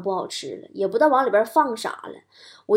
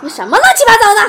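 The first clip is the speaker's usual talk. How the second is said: loud, high-pitched comic exclamation; exasperated outburst; 10 semitones higher, 10 dB louder, faster than usual. Acted. Tricked child protesting at dad, loud exasperation. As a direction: burst out in mock exasperation.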